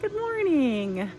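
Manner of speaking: high, slow drawn-out hello; singsong greeting. Real, not acted. Greeting viewers at video start.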